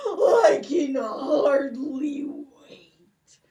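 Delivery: slow whispered gloat between cackles; evil gloating laugh. Acted. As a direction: cackle with greedy delight. Giant gloats eagerly.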